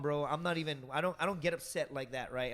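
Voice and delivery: ordinary talk, nothing marked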